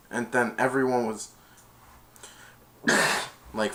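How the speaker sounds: usual speaking voice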